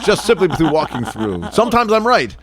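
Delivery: high, bright mock cheer; mock triumphant. Partner teasing her, answering himself in a high mock cheer. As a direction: squeal it like a proud silly victory. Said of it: "you walk into automatic doors".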